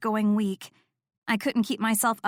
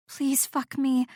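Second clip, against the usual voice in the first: shaky breath; 4 semitones higher, slower than usual. A narrator's reading. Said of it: "with a shaky breath".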